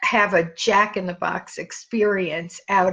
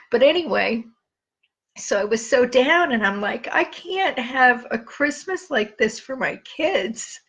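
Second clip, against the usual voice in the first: fighting tears while telling it; holding back tears; 3 semitones higher than usual. Real, not acted. Remembering a hard Christmas for her kids, tearful. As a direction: voice tight with real emotion, close to crying. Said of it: "It makes me cry".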